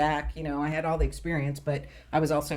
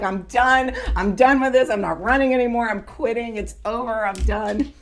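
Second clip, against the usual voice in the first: raised, emphatic quitting rant; retold pissed-off outburst; 5 semitones higher, 5 dB louder than usual. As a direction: replay a fed-up tirade, louder and higher. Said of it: "I was so pissed".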